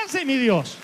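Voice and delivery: loud, high cry of faith; triumphant worship cry; exultant shout. Acted. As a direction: shout it out for the whole church.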